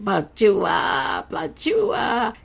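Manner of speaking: voiced fireworks, popping and high; mock firework bursts. Storyteller imitating carnival fireworks, voiced pops. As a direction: make the fireworks go off with your voice.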